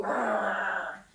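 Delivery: loud rolling growl; long, loud angry "arr"; king's growl. Shown as a demonstration. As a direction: growl like a king in a temper. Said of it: "you go".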